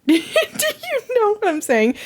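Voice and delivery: high laughing question, slow; laughing while asking the viewers; laughing through words. Real, not acted. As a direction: giggle the words out. Laughing at herself.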